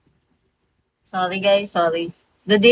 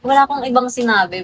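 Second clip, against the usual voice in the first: usual speaking voice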